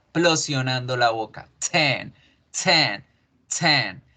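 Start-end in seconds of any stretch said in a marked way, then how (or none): 1.7-4.0 "ten" with a hard burst on the T; explosive T. Shown as a demonstration. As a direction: pop the T. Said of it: "con la T explosionando".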